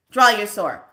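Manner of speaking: loud sharp order; queen ordering troops, loud; barked command. Retold or imitated. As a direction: command the soldiers.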